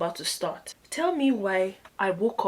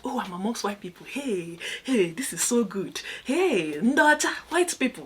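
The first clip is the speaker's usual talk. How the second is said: excited self-talk; 2 semitones higher, 3 dB louder than usual. Retold or imitated. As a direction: gush in wonder like an awestruck newcomer. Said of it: "you just tell yourself".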